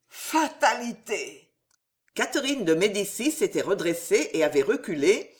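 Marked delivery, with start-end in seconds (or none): none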